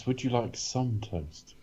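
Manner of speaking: soft, slow toaster impression; a quiet, drawn-out go at the talking toaster; Talkie Toaster. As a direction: offer it slowly and softly, in character. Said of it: "the Talkie Toaster impression".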